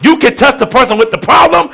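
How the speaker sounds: steady, emphatic sermon teaching; preaching. Acted. Pastor explaining intercession to the church, emphatic. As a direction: hammer home the picture of the gap.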